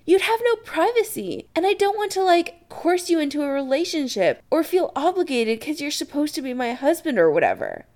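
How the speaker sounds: high, agitated explanation; disbelieving. Acted. Chris to Adam about his privacy, disbelieving. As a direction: protest it, pitch well up. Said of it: "Chris says disbelievingly".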